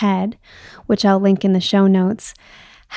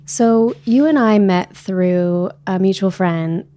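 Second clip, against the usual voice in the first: ordinary talk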